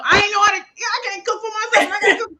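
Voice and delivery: high-pitched exclaimed protest; mock panic. Novice cook protesting she can't cook for herself, in mock panic. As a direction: cry out in comic alarm, voice leaping up. Acts out her panic at cooking.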